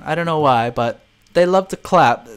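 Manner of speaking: usual speaking voice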